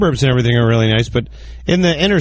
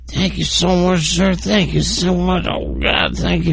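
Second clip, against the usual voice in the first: slow, higher, gushing thanks; grateful beggar; 5 semitones higher, slower than usual. Retold or imitated. The beggar thanking her for a dollar, slow gushing thanks. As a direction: overdo the gratitude like a beggar.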